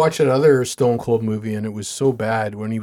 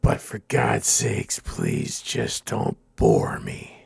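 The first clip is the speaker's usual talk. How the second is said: slow, low, measured delivery; movie tough-guy impression; 3 semitones lower, 6 dB quieter, slower than usual. Recalling Major's adversary's great line, slow low impression. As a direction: drag it out softly, with deliberate pauses. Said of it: "what was that great line that he had?".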